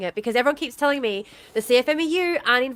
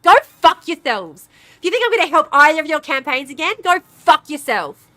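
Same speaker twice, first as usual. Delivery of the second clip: much higher, much louder raging yell; furious shouting; 4 semitones higher, 8 dB louder than usual. Real, not acted. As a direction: scream it at the politicians. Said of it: "I've never been more enraged".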